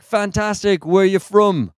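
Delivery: high-pitched London greeter; greeter's London accent. Retold or imitated. Greeter asking a customer, high London accent. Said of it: "And he's like".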